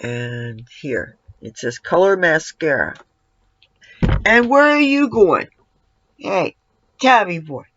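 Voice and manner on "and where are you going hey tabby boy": higher, louder question to the cat; calling after cat. Real, not acted. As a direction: call after the wandering cat.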